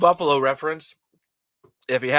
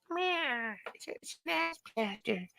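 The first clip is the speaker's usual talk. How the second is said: squeaky creature voice; 10 semitones higher, 13 dB quieter, slower than usual. Pretend. Squeaky voice toy bit.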